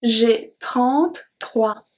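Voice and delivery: the whole sentence read slowly and clearly for learners. Shown as a demonstration. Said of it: "I'm going to read it one more time".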